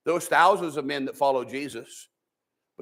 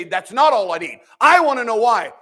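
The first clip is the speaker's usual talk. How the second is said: mock-insistent, louder and higher, "not" punched; demanding complaint; 5 semitones higher, 6 dB louder than usual. People demanding answers from God, mock insistent. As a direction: whine "That's not all I need" with "not" stressed hard. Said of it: "we say".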